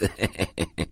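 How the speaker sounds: short, soft cackle; acted chuckle in a quick run; cackling laugh. A narrator's reading. Servant laughs at his own fire news, cackling. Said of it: "Zaśmiał się rechocząco".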